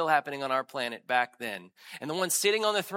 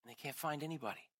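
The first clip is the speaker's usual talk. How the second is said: hushed sermon storytelling; 3 semitones lower, 4 dB quieter than usual. Acted. Preacher to congregation, retelling the futile search in a soft, grave hush. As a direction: draw the congregation in quietly.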